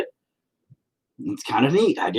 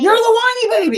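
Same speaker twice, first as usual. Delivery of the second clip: high mocking whine; 5 dB louder than usual. Real, not acted. Dad teasing his son back, high mocking whine. Playful bickering with child.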